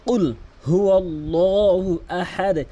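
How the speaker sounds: chanted recitation with bounced দ; slow Quran recitation. Shown as a demonstration. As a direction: recite slowly, bouncing the final দ of Ahad. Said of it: "আহাদ এভাবে বলবেন".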